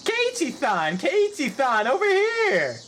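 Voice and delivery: high, loud call across crowd. Acted. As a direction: shout to get his attention. Reporters shouting.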